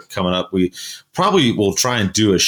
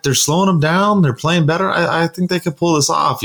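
much higher, eager replay of his own words; retold excited insistence; 9 semitones higher than usual. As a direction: insist they still have a chance, pitched high. Retells arguing his hunch to wife.

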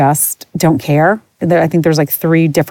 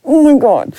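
exasperated; 8 semitones higher than usual. Retold or imitated. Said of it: "And I'm like".

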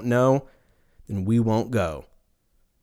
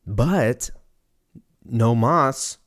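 normal talking voice

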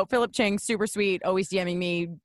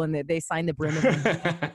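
ordinary delivery

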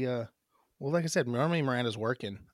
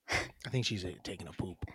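ordinary talk, nothing marked

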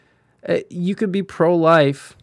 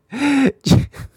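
sudden pitched-up laugh; high laugh; 10 semitones higher than usual. Real, not acted. Streamer laughing at his own Bible joke. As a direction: crack up at your own joke.

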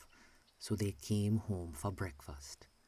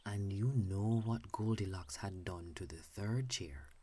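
plain reading voice

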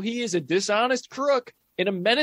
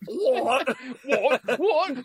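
mock British; 7 semitones higher, much slower than usual. Mocking the failed British accent.

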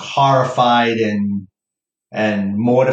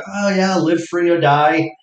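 higher, sing-song reply; mock cheerful quip; 7 semitones higher than usual. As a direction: play along with mock enthusiasm. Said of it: "I'm like".

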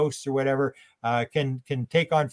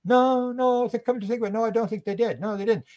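abductee realizing; 9 semitones higher than usual. Retold or imitated. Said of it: "And they go".

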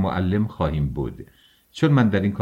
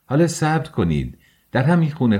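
higher-pitched, mildly raised call; excited cry; 6 semitones higher than usual. A narrator's reading. Razumikhin interrupts to suggest an apartment, crying out. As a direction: cry out to stop them. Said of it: "رازومیخین فریاد زد".

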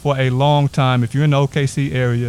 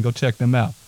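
ordinary talk, nothing marked

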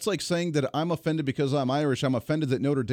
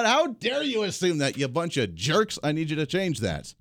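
mock outrage; 3 semitones higher than usual. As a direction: scold like an offended Viking.

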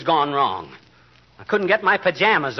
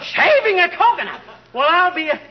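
much higher and louder, incredulous; astonished squawk; 13 semitones higher, 4 dB louder than usual. Acted. Husband stunned by the girl's coconut story, high loud disbelief. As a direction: repeat it back in total disbelief. McGee stunned by Alice's answer.

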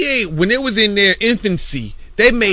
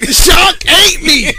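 laughing; 8 semitones higher, 5 dB louder, slower than usual. Real, not acted. Laughing at the joke.

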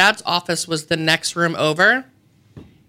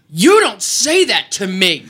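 put-on slow, louder defiant kid; defiant child; 2 semitones higher, 4 dB louder, slower than usual. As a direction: stamp it out like an indignant kid. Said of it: "stomping my feet defiantly".

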